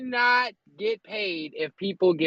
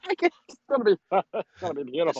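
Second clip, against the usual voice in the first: laughing; faster than usual. Real, not acted. Laughing through sarcastic quote.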